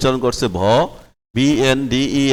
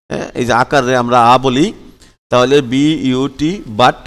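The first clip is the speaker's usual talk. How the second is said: ordinary talk, nothing marked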